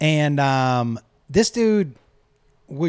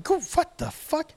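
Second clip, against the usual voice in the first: high, stunned disbelief; young guy caught off guard; baffled; 13 semitones higher, 4 dB quieter than usual. Retold or imitated. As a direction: squeak out shock. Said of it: "I was like".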